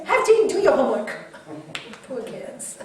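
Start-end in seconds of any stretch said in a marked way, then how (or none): 0.0-1.1 mock nagging parent. Mocking parents nagging kids.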